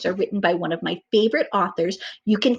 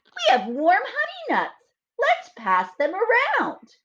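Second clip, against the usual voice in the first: gentle critters; 13 semitones higher, slower than usual. Acted. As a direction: offer it shyly like small critters. Gopher and Mole voiced.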